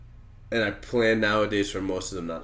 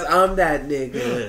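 mock boast; 5 semitones higher, slower than usual. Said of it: "when they fail to realize".

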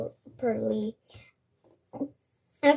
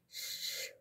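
unvoiced shush again; 16 dB quieter than usual. A narrator's reading. Grandfather silences Rahib once more, whispered shush. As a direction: hush him with a quiet shh. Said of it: "repeated the grandfather".

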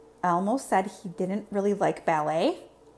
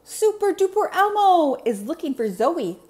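high, loud superhero call; Elmo calling out; 9 semitones higher, 7 dB louder than usual. Acted. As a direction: announce yourself like a little superhero. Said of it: "Elmo called as he walked".

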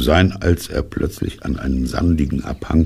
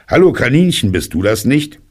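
higher, louder hail, rising question; calling out; 9 semitones higher, 4 dB louder than usual. A narrator's reading. Bear hails rabbit down the hole, calling. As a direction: call down the hole hopefully.

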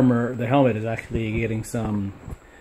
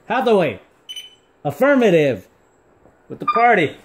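excited command; 8 semitones higher, 4 dB louder than usual. Real, not acted. Commanding the party mode.

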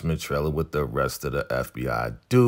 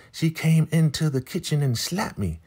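high mock Marva voice; 11 semitones higher than usual. Host imitating Marva protesting her innocence, high and whiny. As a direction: pitch way up, a whiny denying Marva.

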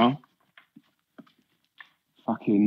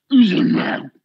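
incredulous shout; 13 semitones higher, 4 dB louder than usual. Real, not acted. Repeating, rising to outrage.

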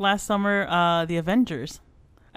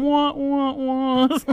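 imitated comedy wah-wah, pitched up; sad-trombone wah-wahs; 8 semitones higher, 3 dB louder, much slower than usual. Shown as a demonstration. Joking about what the editor will add, mock trombone wahs. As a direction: mimic a failure sound effect. Imitates a comic failure sound effect.

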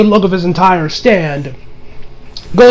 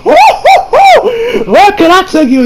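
much higher and louder, slow hooting shout; hammed-up sleazy flirt; 12 semitones higher, 4 dB louder, much slower than usual. Creep hitting on the woman, loud high hooting. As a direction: whoop and holler like a cartoon lech. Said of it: "he's like".

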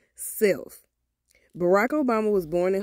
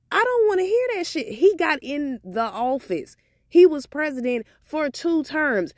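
getting mad; 6 semitones higher than usual. Real, not acted. Ranting at Obama's critics to viewers, heated and much higher. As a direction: let the anger boil over as you defend him. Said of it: "I'm getting mad".

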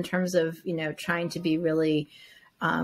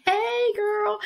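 much higher-pitched imitation of the booth owner's hello; imitating her greeting. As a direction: pitch it way up for a mimicked "hey, girl". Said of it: "I'm like".

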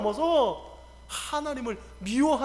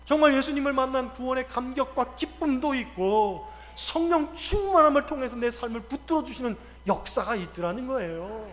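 normal talking voice

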